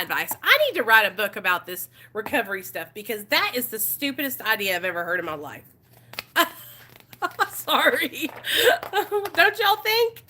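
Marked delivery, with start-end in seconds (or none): 6.4-10.2 high, laughing through the words; helpless laughter breaking into the question. Real, not acted. Streamer cracking up over silly workbook advice, laughing.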